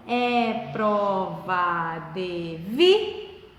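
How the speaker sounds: very slow, low syllable count that rises sharply on "vi". Shown as a demonstration. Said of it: "parar de contar no vi". (sílaba por sílaba, subindo e parando no "vi")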